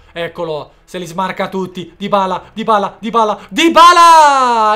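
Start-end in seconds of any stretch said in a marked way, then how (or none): 2.0-4.7 building to a shout; rising excited play-by-play; excited commentary. Real, not acted. Goal chance commentary building.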